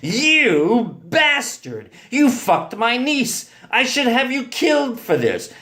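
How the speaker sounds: furious accusation, pitched high; screamed in fury. A narrator's reading. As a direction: bellow it as an outraged uncle. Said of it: "and screamed".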